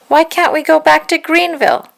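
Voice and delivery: put-on high, loud little-boy question; little brother pleading. A narrator's reading. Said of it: "my little brother Harry asked".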